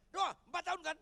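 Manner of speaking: high, forceful shouted answer; loud shout. Retold or imitated. Pastor urging prayer for four-year-old, shouting. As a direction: belt it out without hesitation. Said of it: "Saya keras langsung".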